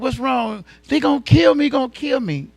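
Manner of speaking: put-on high, loud frightened cry of a girl; voicing the terrified girl, pitch jumping up; panicked plea. Girl begging a stranger for help, panicked and loud.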